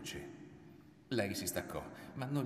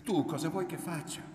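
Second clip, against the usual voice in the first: high, louder challenge with falling end; insistent demand; 9 semitones higher, 5 dB louder than usual. A narrator's reading. Woman challenging her silent lover, high and insistent. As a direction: throw the question at him forcefully. She demands an answer from him.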